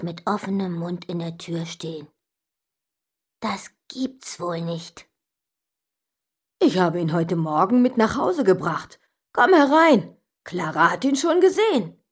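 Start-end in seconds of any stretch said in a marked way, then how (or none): 3.4-5.1 very soft, slow, low disbelief; stunned murmur. A narrator's reading. Boy stunned that uncle has parrot, hushed disbelief. Child stunned at losing the bet. 6.6-12.0 lightly voiced uncle character; cheerful uncle. A narrator's reading. Uncle invites child to see parrot, lightly voiced welcome.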